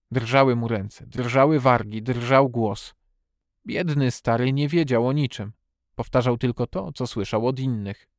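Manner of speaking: ordinary delivery, nothing marked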